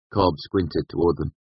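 ordinary delivery, nothing marked

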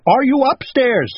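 high-pitched shouted question; shouting. A narrator's reading. Owl shouts upstairs to himself, high shout. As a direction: holler the question up the stairs. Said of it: "he shouted".

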